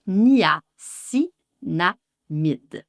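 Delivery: "niacinamide" stretched, each syllable clear; slow "ni-a-ci-na-mide"; very slow pronunciation. Shown as a demonstration. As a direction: drag out "niacinamide". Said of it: "Il y a aussi du niacinamide".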